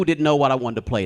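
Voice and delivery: usual speaking voice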